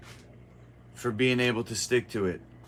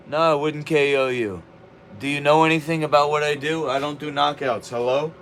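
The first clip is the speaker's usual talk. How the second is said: ordinary talk, nothing marked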